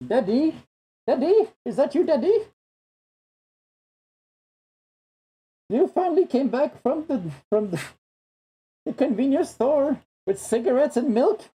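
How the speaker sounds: put-on high small child calling for dad; squeaky child asking if it's daddy; little kid voice. Voicing an abandoned child.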